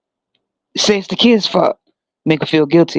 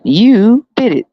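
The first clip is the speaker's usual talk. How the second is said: big cheer; 6 semitones higher, 4 dB louder, slower than usual. Pretend. Cheering crowd, bigger take.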